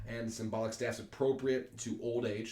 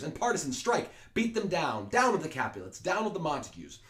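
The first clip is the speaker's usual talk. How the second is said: crowd shouting; 6 semitones higher, 5 dB louder than usual. Acted. Reading the citizens' cries.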